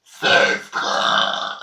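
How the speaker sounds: a single burped word. Shown as a demonstration. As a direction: say "Sestra" on a burp. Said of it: "en rotant".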